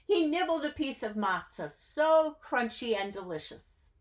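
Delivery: plain reading voice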